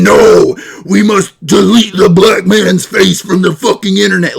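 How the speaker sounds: loud, mocking shout of a preachy scold. Streamer mocks chat orbiters with a loud parody shout. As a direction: yell it as a parody of a censor. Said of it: "you're saying".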